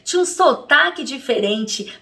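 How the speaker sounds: usual speaking voice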